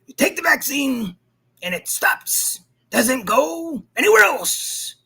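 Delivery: mocking Maddow voice; Rachel Maddow impression. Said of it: "That's my best impersonation of".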